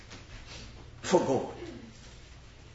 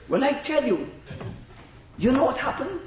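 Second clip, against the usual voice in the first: ordinary delivery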